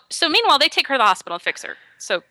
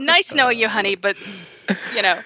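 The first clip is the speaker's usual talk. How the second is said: mock brush-off; faster than usual. Boyfriend abandoning injured girlfriend, mock apologetic brush-off. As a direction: play a boyfriend bailing out, hurrying at the end.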